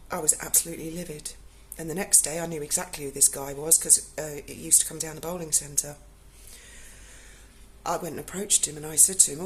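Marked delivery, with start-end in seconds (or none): none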